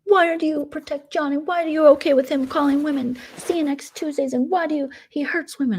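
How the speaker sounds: high, whiny imitation of Mom; mocking Mom. Imitating Mom grilling the host in a high whine. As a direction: squeak out Mom's accusing questions. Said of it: "And Mom was like".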